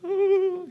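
high-pitched pretend whimpering; imitated baby cry. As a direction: copy a little one's put-on sobbing. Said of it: "that's how he cries all the time".

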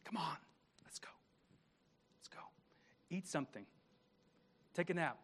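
gentle whisper. Retold or imitated. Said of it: "just whispering to him".

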